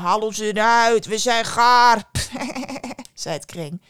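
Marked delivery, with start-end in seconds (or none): 0.0-2.1 loud, slow, drawn-out bread voice; calling loaves. Acted. Bread in the oven calls the girl, slow loud calling. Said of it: "de broden waren die haar toeriepen". 2.1-3.1 short run of giggling laughter; scornful snicker. Acted. Daughter laughs off the loaves' plea, scornful snicker.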